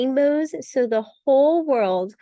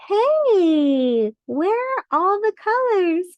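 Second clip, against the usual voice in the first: high, bright startled crayon; 7 semitones higher, slower than usual. Acted. Crayon looking around for colors, high and surprised. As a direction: call out high like a tiny crayon.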